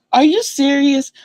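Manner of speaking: high-pitched disbelieving question; incredulous. Retold or imitated. Dreamer reacting to grandmother's answer, incredulous. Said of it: "I actually said it out loud for real".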